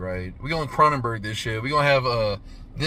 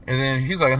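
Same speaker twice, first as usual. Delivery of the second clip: normal talking voice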